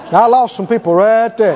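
ordinary delivery

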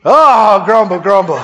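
loud groaning grumble that drops away; mock grumbling. Seeing oneself in the mirror, loud mock grumbling. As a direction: groan loudly, hammed up. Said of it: "look in the mirror".

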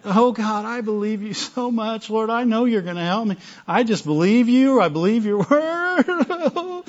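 high, hammed-up tearful whine; mock whining. Preacher imitating someone whining in faith, exaggerated whining. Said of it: "seen somebody whine in faith".

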